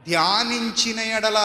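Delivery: slow, solemn Bible quote; stately, unhurried intoning of a verse; scripture recitation. Acted. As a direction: draw the verse out reverently. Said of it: "అన్నాడు".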